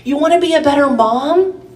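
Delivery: rising, fervent sermon voice; preaching. Acted. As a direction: preach it with conviction. Preaching rises again.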